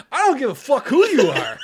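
hammed-up retold angry shout; defiant outburst. Third class defying a petty officer pulling rank, shouted defiance.

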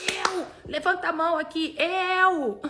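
rally the crowd with a bright shout; excited shout. Shown as a demonstration. Coach calling viewers to raise their hands, excited shout. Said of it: "Fala aqui, eu!".

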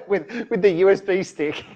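joking through laughter; laughing. Real, not acted. Cohosts joking about a carrier pigeon, laughing.

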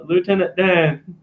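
stretched-out movie-character imitation; Forrest Gump. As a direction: call out in a simple Southern drawl. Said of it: "piss off Tom Hanks".

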